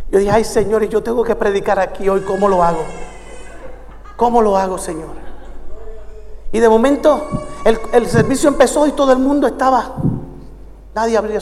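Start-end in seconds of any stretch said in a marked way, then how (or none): 0.3-5.2 low, soft aside to God; hushed helpless plea. Retold or imitated. Scolded preacher asking God how to preach here, hushed plea. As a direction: plead under your voice, trailing away.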